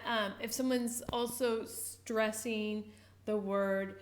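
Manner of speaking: ordinary talk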